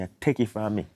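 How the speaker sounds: normal talking voice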